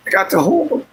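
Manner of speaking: fighting back tears, voice breaking up; crying. Real, not acted. Tearful to his stream viewers about his babies, voice breaking. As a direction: voice cracking high through real tears. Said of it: "He don't like watching me cry".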